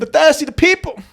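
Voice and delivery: very high, badly faked Cockney; hammed-up high-pitched Cockney. Shown as a demonstration. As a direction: squeak out a silly British accent.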